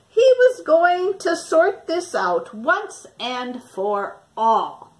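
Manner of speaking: put-on lively storybook narration for young listeners; reading to children. Teacher reading Stuck to her class, lively storytelling voice. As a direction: tell it like a picture book to a class. Teacher reads picture book aloud.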